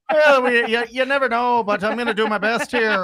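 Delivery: hammed-up Scottish brogue, slipping into Irish; mock Scottish accent. Host promises to try his best, mock Scottish accent. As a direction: put on a leprechaun-like Scottish accent. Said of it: "read it in a Scottish accent".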